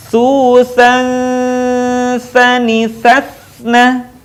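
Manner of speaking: slow chant with "Susan" held long; held nasal. Shown as a demonstration. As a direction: hold the closing hum of "Susan" into the s. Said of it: "maka tahan suaranya". ("Susan" ditahan dengung, menutup ke huruf s)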